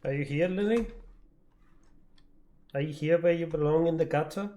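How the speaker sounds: usual speaking voice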